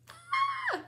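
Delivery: very high squeal; excited squeal. Real, not acted. Grandmother celebrating the finished exchange, squealing.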